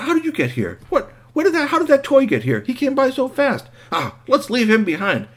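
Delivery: put-on high, loud, incredulous boys' voices; astonished boys. Boys finding the toy already there, amazed and annoyed. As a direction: squawk it in disbelief.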